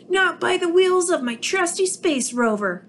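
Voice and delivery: squeaky defiant alien voice; small alien shouting back defiantly; Bork crying out. Acted. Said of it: "cried Bork".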